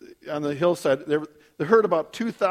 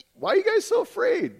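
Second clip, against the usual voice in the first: very high-pitched mock bewilderment; comic incredulous question. Just-woken Jesus puzzled at his disciples, comic high-pitched question.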